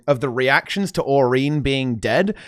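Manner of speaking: ordinary talk, nothing marked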